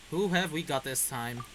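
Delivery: usual speaking voice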